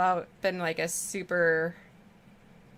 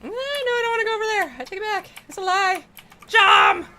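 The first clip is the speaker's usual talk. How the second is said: much higher and faster, squeaky cartoon troll; bad troll voice; 7 dB louder than usual. Playing a cowardly troll for viewers, squeaky and hammed-up. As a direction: ham up a silly troll backing out, ending in a loud shout. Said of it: "so that's my bad troll accent".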